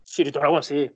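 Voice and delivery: ordinary talk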